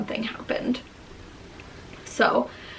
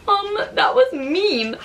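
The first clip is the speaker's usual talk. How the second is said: hurt; 10 semitones higher, slower than usual. Retold or imitated. Hurt reply to husband.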